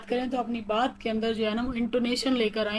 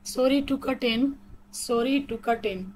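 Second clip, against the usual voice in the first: very slow, words spaced out evenly; slow robotic reading; much slower than usual. Shown as a demonstration. Teacher showing how to interrupt politely, slow and robotic. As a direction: lay out each word for learners. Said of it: "थोड़ा robotic वे में पढ़ के बता रही हूँ".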